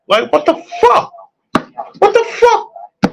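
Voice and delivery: high, disbelieving what the fuck; incredulous. Real, not acted. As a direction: squeal it out in disbelief, laughing. Incredulous rant peak.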